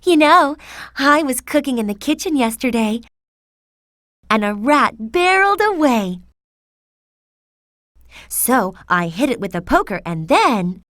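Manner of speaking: chatty wife prattling on loudly; loud chatter. A narrator's reading. Chatty wife telling about a rat in the kitchen, loud chatter. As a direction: chatter away at full volume. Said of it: "she chatted so loudly".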